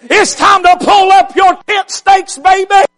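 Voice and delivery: whooping revival preacher in full flight; full-force preaching. Acted. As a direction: preach it hard and build to the shout.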